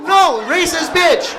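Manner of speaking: slow, high, loud exasperated shout. Retold or imitated. Son yelling at his mom in front of his friend, slow and loud. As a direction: yell it out slowly in disbelief. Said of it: "I'm like".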